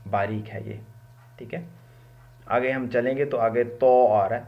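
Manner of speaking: usual speaking voice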